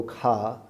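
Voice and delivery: car as a long کھا with no R; British pronunciation. Shown as a demonstration. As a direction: draw out کھا, puffed K, silent R. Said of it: "وہ آر کی آواز نہیں نکال رہے". ("کھا" لمبا، ر کی آواز کے بغیر)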